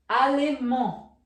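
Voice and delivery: Alemão loud as French "a-le-MAN"; silent final D. Shown as a demonstration. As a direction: say Alemão with a nasal end, no D. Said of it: "com D no final". (Alemão à francesa, D final mudo)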